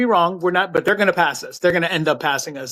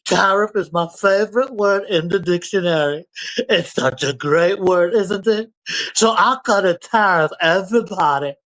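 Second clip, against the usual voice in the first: mock Trump; 3 semitones higher than usual. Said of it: "he was gonna be like".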